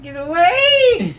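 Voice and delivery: crying out, loud and high; distressed cry. Real, not acted. Mother pleading, being pestered.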